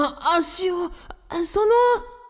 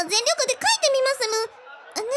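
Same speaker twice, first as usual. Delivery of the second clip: much higher, fast, squeaky put-on character voice; 11 semitones higher, 3 dB quieter, much faster than usual. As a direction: jump way up into a quick, strange character voice. Margarita vows in her voice. (甲高く早口の作り声)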